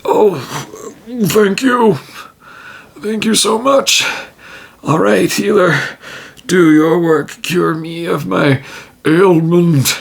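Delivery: a sick orc begging to be cured; grateful orc. Acted. Orc thanks the healer.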